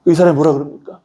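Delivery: normal talking voice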